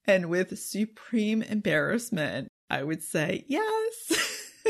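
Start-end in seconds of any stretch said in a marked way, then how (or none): 3.5-4.7 very high, sheepish yes through a laugh; embarrassed giggly yes. Retold or imitated. To teasing coworkers at work, a high embarrassed giggling yes. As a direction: squeak it out, mortified and laughing. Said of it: "With that kind of voice".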